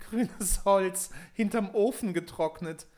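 high, loud haughty jeer; a spoiled princess poking fun; mocking princess. Spottende Prinzessin. (hohe, laute, hochnäsige Spottstimme)

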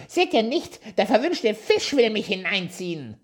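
very high, loud frantic cry; panicked dwarf shouting. A narrator's reading. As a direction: yell while being dragged to the water. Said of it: "schrie der Zwerg".

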